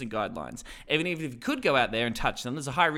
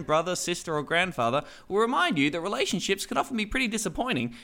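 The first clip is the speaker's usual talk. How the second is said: ordinary talk, nothing marked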